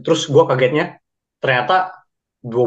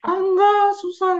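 much higher, hammed-up whiny voice; mocking whiny refusal. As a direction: parody a friend who won't even try. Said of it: "gua menawarkan ya".

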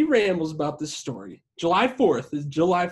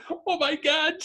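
imitated tearful outburst; 12 semitones higher, slower than usual. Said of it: "She starts crying".

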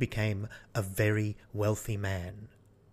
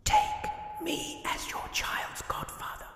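put-on high, breathy old man's whisper; old Death's voice. Death asking to be godfather, in a whispered old voice. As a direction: whisper it like a frail old man. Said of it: "the old man said".

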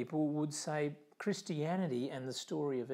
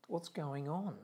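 ordinary talk, nothing marked

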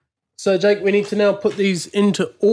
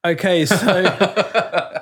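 laughing; much slower than usual. Real, not acted. Laughing while ranking albums.

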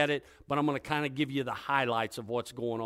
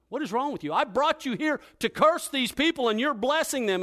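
indignant king; 9 semitones higher than usual. Acted. Angry king scolds the prophet.

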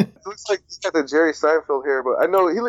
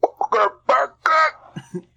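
very slow, much higher silly voice; hammed-up catchphrase; 12 semitones higher, much slower than usual. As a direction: drag it out in a high put-on voice. Repeats the running accent bit.

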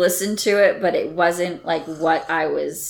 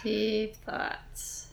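wry echo; 4 semitones higher, 12 dB quieter than usual. Real, not acted. Echoing the joke wryly.

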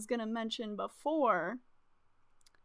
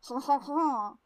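hammed-up French chuckle; French-accented ha-ha-ha; mock French laugh; slower than usual. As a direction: laugh like a cartoon French person. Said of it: "sorry, they're French".

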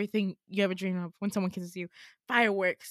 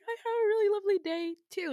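much higher, quick and giddy; gushing reply; 10 semitones higher, faster than usual. Retold or imitated. Girl answering her date after a lovely day, high and giddy. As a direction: swoon back at him in a high voice. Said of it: "And I was like".

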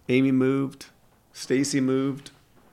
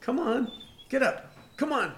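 high coaxing dog voice; 12 semitones higher, 4 dB quieter than usual. Real, not acted.

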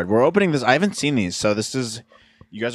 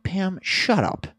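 mock exasperated; 10 semitones higher, much slower than usual. Mock scolding TV character.

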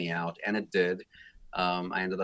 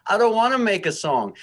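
put-on high, loud whiny child; whiny kid voice; 13 semitones higher, 9 dB louder than usual. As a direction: whine it like a kid refusing. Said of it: "exactly with that same whiny voice".

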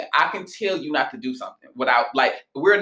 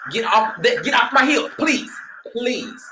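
pitched-up, pestered pleading; exasperated plea; 7 semitones higher, slower than usual. Acted. Reader to a pestering client, exasperated plea. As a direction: beg them to back off, voice climbing.

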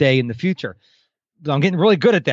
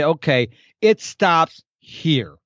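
firm and slow; much slower than usual. Pretend. Setting a firm limit.

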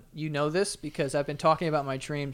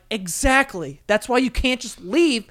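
higher, exasperated parental lecture; retold telling-off, voice raised; scolding dad; 4 semitones higher, 5 dB louder than usual. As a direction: lay down the law to a child. Dad scolding his son in story.

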